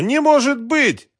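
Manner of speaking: very high, drawn-out cry; desperate scream. A narrator's reading. Woman finding the pistol empty, high desperate scream. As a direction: wail loudly in disbelief. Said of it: "закричала она".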